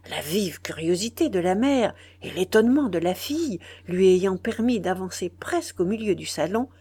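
ordinary delivery

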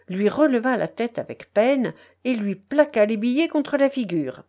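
plain reading voice

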